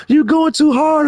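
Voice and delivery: high-pitched imitation of a complainer; mock scolding. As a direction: mimic a fretful scold way up high. Acting out someone scolding him.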